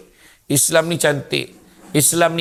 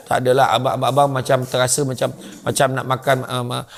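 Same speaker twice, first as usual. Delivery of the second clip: low, halting stammer; timid husband; 4 semitones lower, faster than usual. Acted. Husband afraid to tell wife what he craves, faltering. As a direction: backpedal nervously, tripping over words.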